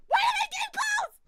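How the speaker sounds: very high, loud screamed question; screaming in horrified disbelief; anguished shriek. Real, not acted. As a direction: shriek it at the top of your range. Game closed, streamer escalates.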